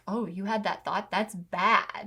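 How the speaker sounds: mock disapproving gasp, then a soft scold; a hint of shocked judgment; judgmental tone. Shown as a demonstration. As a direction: lightly scold the thought. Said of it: "it's this, like".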